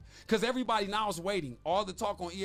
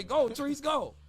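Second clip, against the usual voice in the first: higher mock cheer; imitated cheering hangers-on; 7 semitones higher, 4 dB louder, slower than usual. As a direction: egg him on like a hanger-on. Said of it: "hang around you wanna say".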